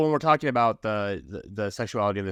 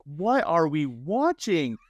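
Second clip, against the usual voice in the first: slow, drawn-out confused question; baffled disbelief; 6 semitones higher, much slower than usual. Retold or imitated. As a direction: ask it like you can't believe your eyes. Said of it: "And I was like".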